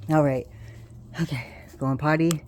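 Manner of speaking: usual speaking voice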